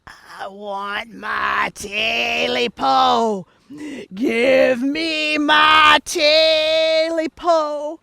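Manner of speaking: ghostly creature voice. Acted. Creature demanding its tail back from the man, slow eerie moan. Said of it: "a voice that said".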